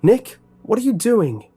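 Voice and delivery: high, loud alarmed shout after a friend; loud panicked call down the hallway. Acted. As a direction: yell after him as he runs off. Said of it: "I shouted".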